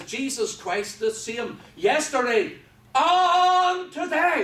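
loud, slow, with a huge drawn-out high "and today"; emphatic proclamation. Shown as a demonstration. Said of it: "That's the way it reads".

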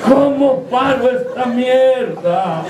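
very slow, drawn-out mock holler; mock yell. Old man calling down from the flying donkey, drawn-out mock yell. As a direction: stretch every word like a distant call. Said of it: "se asomó por un lado del burro y gritó".

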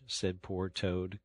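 usual speaking voice

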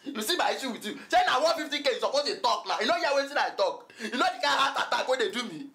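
high-pitched, worked-up outburst; agitated protest. Acted. Debtor protests to friend about his heart attack, agitated. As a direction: protest as if badly wronged.

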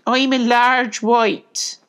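high, loud, drawn-out piglet voice. A narrator's reading. Piglet introducing himself to collie, high and slow. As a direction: announce it slowly in a high piglet voice.

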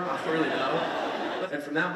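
slow, laughing it off; chuckling through the words. Real, not acted. Speaker jokes to the audience about 3D movies, laughing. Shrugging off a joke.

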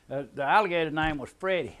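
ordinary delivery

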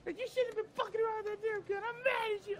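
high, scolding girlfriend impression. Mocking girlfriend scolds him over the Nerf gun, high and put-on. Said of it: "She was like".

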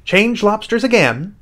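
high, excited storybook yell. A narrator's reading. Gryphon yelling dance steps at the top of its voice. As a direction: yell out the next step, pitch way up. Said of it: "yelled the Gryphon at the top of its voice".

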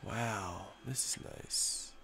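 soft whisper; whispered awe. Real, not acted. Pilot admiring the view, whispering in awe. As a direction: breathe it out in wonder.

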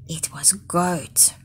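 secretive whisper; whispered answer. Real, not acted. Teacher revealing the answer to children in a whisper.

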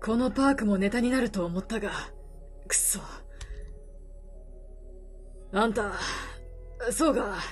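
put-on gruff weary older man, low. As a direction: speak low like a tired drifter. Voices the reporter.

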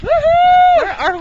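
loud, high, drawn-out call; mock announcer shout. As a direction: belt it like an announcer.

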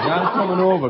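ordinary talk

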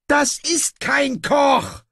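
high, drawn-out outraged wail; howling. A narrator's reading. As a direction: howl it slowly at the top of the voice. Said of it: "heulte er". (empört heulend)

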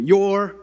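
"your" high, loud and left hanging; cue to finish. Real, not acted. Preacher has the congregation finish the verse, prompting high. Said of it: "y'all finish it for me".

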